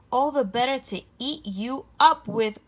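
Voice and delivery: mock growl as the wolf pounces; the wolf threatening the little girl, growling. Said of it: "growled the wolf".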